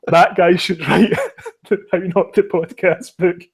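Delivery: words broken by laughter; laughing while talking. Real, not acted. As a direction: giggle through the line. Says the line while cracking up.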